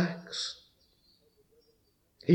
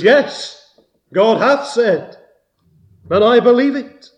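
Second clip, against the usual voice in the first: loud, high, ringing declaration; Faith's bold answer; 8 semitones higher, 9 dB louder than usual. Acted. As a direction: proclaim belief in defiance of the tempter. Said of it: "Faith answers".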